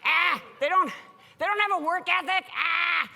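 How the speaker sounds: hammed-up grumbling boss; exasperated executives. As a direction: rant like a fed-up old executive griping about millennials. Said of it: "And they're like".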